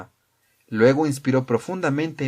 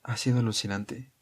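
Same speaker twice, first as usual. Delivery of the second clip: soft, understated awe; hushed amazement; impressed; 3 semitones lower, 6 dB quieter than usual. A narrator's reading. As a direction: marvel at it quietly. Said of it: "dijo Wells impresionado".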